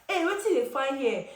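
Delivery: high, surprised Pidgin call of recognition; surprised Pidgin greeting. Retold or imitated. One guy recognising the driver among the boys, surprised Pidgin call. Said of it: "saw him and was like".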